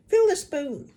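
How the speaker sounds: put-on high, loud old hag order; commanding Grandma. A narrator's reading. Grandma orders George to fill the spoon. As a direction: bark it like a bossy grandmother.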